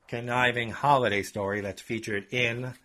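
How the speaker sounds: ordinary delivery, nothing marked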